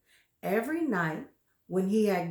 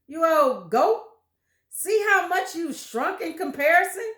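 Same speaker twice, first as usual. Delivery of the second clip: higher, louder mean jeering; taunting husband; 6 semitones higher, 6 dB louder than usual. Acted. Husband mocking wife's shrinking, loud jeer. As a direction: taunt the old goat with glee.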